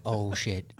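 hushed, jolted awake; quiet panic of a waking recruit; startled mutter. Retold or imitated. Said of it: "he pops up like".

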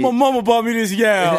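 high, loud comic outburst; mock shout. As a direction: yell it for the laugh. Shouted joke amid loud laughter.

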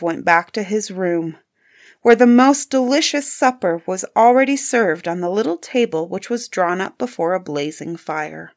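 ordinary delivery, nothing marked

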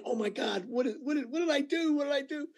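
hushed, climbing mock panic. Actor recalls thinking he had upset the star, mock panic. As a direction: replay a panicked thought, voice climbing high. Retold panicked thought on set.

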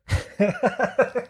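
high, quiet giggle at own silly joke; real high-pitched laughter trailing off; helpless laugh. Real, not acted. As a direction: crack yourself up. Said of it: "It made me laugh".

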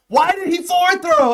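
high-pitched, disbelieving question; incredulous outcry. Retold or imitated. Spectator retelling his reaction to a bad throw, incredulous outcry. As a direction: cry out in mock disbelief.

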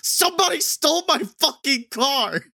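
high, loud angry yell; all-caps shout. Retold or imitated. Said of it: "Warhorse in all caps".

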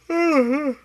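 long weary sigh; heavy sigh. Real, not acted. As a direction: sigh it out, worn out. Tired sigh while podcasting.

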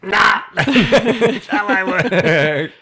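hammed-up stubborn boss shout; mock yell. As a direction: yell the manager's refusal, cracking up. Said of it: "here I am yelling into the microphone".

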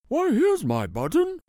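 light joyful exclaim; excited cry. Acted. Bear finding his button on the mattress, mild happy cry. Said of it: "he cried".

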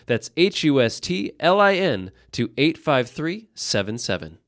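upbeat radio ad voice; ad read. Acted. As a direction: read it like a local radio spot. Host reads a sponsor ad.

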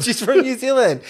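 high, loud mock-helpful explanation; eager insistence. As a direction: imitate a keen tourist pressing the point. Acting the couple's eager insistence.

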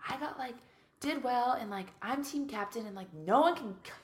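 mock self-pitying diva complaint; diva entitlement. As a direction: plead your case like an entitled diva. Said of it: "being a little bit of a diva".

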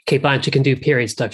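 ordinary delivery

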